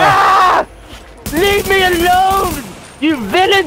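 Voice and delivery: high, slow hammed-up plea; melodramatic cry. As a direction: ham up a damsel in distress. Mock-dramatic cry at enemies.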